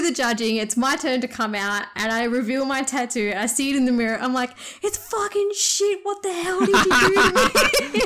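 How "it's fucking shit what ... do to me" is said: high, hammed-up outraged yell at the tattooist; mock yell. Dreamer yelling at tattoo artist over ruined tattoo, mock outrage. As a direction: act horrified, yelling at him in jest. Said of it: "the person who I was yelling at".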